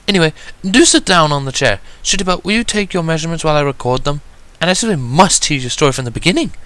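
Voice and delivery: high, hurried refined lady; rapid excuse. A narrator's reading. Rarity hurriedly smoothing over an awkward moment, high and quick. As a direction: brush it off quickly and politely. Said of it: "she rapidly excused".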